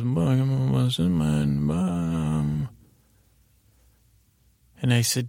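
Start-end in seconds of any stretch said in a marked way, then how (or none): 0.0-2.7 long, drawn-out nonsense mumble; Mumble Bear. Acted. Bear explains the ringing alarm, mumbling. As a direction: stretch the garbled syllables slowly. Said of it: "I'm mumbling to Mumble Bear".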